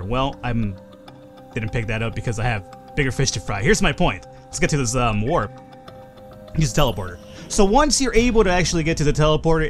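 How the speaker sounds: usual speaking voice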